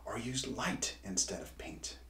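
breathy hushed words; whisper. A narrator's reading. Hugo musing on painting with light, whispering. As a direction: let it fall to a secret whisper.

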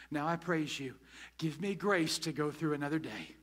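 quiet prayer. Pretend. Pastor modelling a calmed prayer after the rant, soft and humble.

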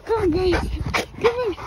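urgent come-along call. Real, not acted. Calling the dogs to come.

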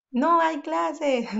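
slow happy exclamation. Shown as a demonstration. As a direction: stretch it out like a delighted kid.

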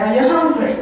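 ordinary delivery

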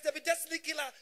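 usual speaking voice

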